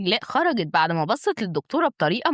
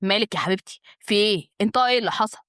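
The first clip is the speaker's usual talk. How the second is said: ordinary delivery, nothing marked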